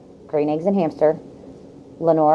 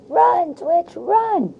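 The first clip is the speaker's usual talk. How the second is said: high, loud urgent yell; 13 semitones higher, 3 dB louder, much slower than usual. Acted. Small animals urging Twitch to flee, high yell. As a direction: scream at him to run. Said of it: "a rabbit, and a rat were yelling".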